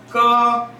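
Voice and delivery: 꺼 held high and level; first tone. Shown as a demonstration. Teacher reading ge in a high level first tone. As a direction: say 꺼 high and flat like a Mandarin first tone. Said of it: "G의 음가도 영어랑 똑같습니다".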